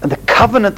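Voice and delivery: "covenant" leaned on at its first syllable; stress on COVENANT. Shown as a demonstration. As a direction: punch the start of "covenant". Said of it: "again we've brought these emphasis".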